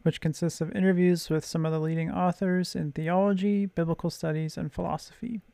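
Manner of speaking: polished, upbeat host announcement; podcast intro read. Acted. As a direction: read a scripted intro to listeners, evenly projected. Host reads scripted podcast intro.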